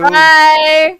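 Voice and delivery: loud drawn-out "bye"; breezy shouted farewell; calling out goodbye. Acted. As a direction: call it back while running off. Heroes calling out while running off.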